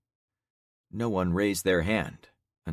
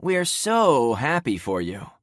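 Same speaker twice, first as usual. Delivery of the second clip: slow, dry sarcastic voice; dark sarcasm; 7 semitones higher, 6 dB louder, slower than usual. Acted. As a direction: drip the words with scorn. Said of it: "someone said with dark sarcasm".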